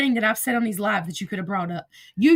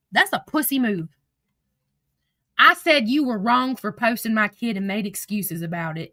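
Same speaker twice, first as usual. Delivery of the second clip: normal talking voice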